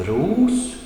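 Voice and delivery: "ros" alone, high and rising; single-word tone. Shown as a demonstration. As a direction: swing "ros" sharply upward as a separate word. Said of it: "then another single word".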